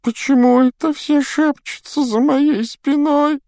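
put-on high, slow whimpering ghost girl; nasal whine. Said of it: "захныкала Миртл".

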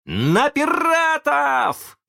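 very high, drawn-out rallying call; battle cry. A narrator's reading. Boy leads friends against pirates, rallying cry. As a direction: send them charging, stretching each word high.

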